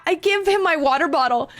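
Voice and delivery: usual speaking voice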